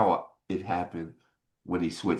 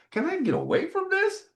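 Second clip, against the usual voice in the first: much higher, pleading inner voice, exasperated; exasperated plea. Retold or imitated. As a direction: cry out to get away from it, mock despairing. Frustrated inner voice, receiver again.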